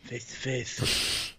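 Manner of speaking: "Face to face" whispered slowly with a lisp, s turned to th. Shown as a demonstration. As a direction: breathe "Face to face" slow, every s lisped into th. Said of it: "but with a lisp".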